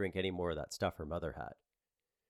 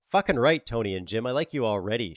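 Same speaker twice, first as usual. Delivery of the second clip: exclaiming; 3 semitones higher, 8 dB louder than usual. Acted. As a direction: blurt it out with delight. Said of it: "she exclaimed".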